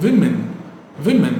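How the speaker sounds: women as WIM-in; plural pronunciation. Shown as a demonstration. Teacher modelling the plural, plural pronunciation. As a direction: say women with a short i opening. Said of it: "அதை நீங்க சொல்லும்போது".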